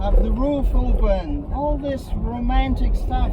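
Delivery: loud, high, projected over the engine. Real, not acted. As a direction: call it out over the noise of the drive. Talking over engine while driving.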